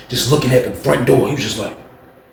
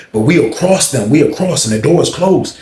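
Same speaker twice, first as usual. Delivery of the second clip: ordinary delivery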